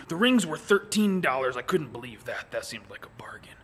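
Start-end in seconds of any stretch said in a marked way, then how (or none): 1.6-3.6 low unvoiced whisper aside. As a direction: confide it in a whisper.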